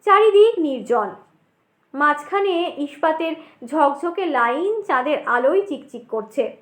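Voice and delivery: plain reading voice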